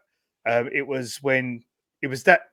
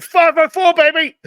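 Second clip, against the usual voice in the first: put-on high, loud drunken whoop of "Five oh four, baby"; drunken cheer; 11 dB louder than usual. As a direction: yell it out like a tipsy fan in the crowd. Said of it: "And I just went".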